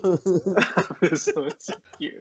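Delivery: slow, high, loud echo through laughter; laughing mimicry. Teasing a friend by echoing his words, laughing mimicry. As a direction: repeat it mockingly, stretched out and laughing.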